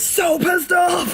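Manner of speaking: loud, high-pitched burst on the last words; shouted punchline. Real, not acted. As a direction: belt out the punchline, drawing out the final word. Gleeful shouted punchline of her story.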